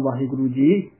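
ordinary talk

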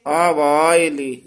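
ਆਵਾਏ with both long aa held slow; long kanna vowels. Shown as a demonstration. ਗ੍ਰੰਥੀ ਵਿਦਿਆਰਥੀ ਨੂੰ ਸਿਖਾਉਂਦਾ: ਆਵਾਏ ਲੰਮਾ ਖਿੱਚ ਕੇ. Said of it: "ਦੋਵੇਂ ਕੰਨੇ ਲਾ ਕੇ ਉਚਾਰਨ".

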